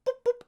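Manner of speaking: very high, playful boops; gentle boop sounds. Acted. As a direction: tap their heads with a silly sound. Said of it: "he goes".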